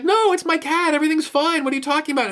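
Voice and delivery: high, breezy mock denial; high innocent brush-off; Captain Marvel. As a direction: play it innocent in a high airy voice. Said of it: "she's like".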